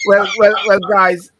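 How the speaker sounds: host loudly calling the panel to order; loud wrap-up call. Real, not acted. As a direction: raise the voice to take the room back.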